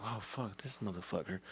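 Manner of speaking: low, soft aside; under-the-breath disbelief; muttered dismay. Acted. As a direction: mutter it to yourself. New hire's muttered reaction.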